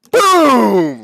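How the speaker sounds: huge high, drawn-out boom; very loud, high-pitched impact yell; explosive shout. Acted. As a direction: burst out like a punch landing. Acting out the punch landing.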